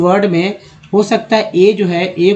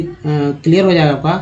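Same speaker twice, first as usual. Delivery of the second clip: normal talking voice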